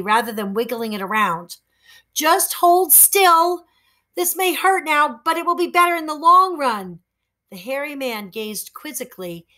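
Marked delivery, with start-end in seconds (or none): 2.1-7.0 steady him before the yank; reassuring boy. Acted. Boy reassuring the hairy man before pulling.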